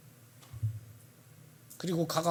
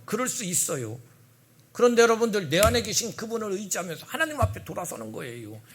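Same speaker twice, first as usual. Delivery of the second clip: normal talking voice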